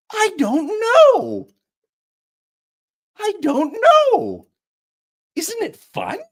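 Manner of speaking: very high, slow sing-song lilt; carefree chirrupy singsong; chirrupy Pepper. Acted. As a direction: float it up high and playful. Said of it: "chirrupy sing-song voice".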